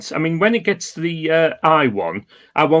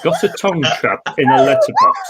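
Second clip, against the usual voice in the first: squeaky high; faster than usual. Acted. Reading absurd news story.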